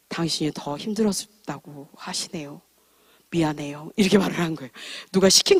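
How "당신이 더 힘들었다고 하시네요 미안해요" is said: soft, slow retold apology; quiet apology. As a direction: say sorry gently, holding back. Apologizing to husband. (남편에게 조용히 사과하듯)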